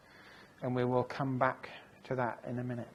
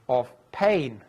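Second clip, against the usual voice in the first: pain hit much louder at the line end; the last word pain punched loud and falling; stress on pain; 8 dB louder than usual. Shown as a demonstration. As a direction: land hard on the word pain. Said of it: "emphasizing the last word".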